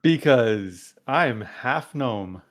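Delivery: slow, drawn-out in-character answer; a hint of a character voice, very deliberate; Qualo.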